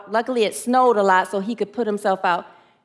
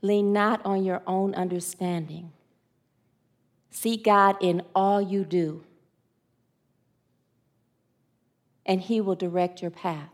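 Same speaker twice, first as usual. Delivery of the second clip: slow, soft, solemn scripture; scripture recitation; 4 semitones lower, 7 dB quieter than usual. Acted. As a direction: recite it slowly like a prayer.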